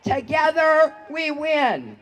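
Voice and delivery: ordinary talk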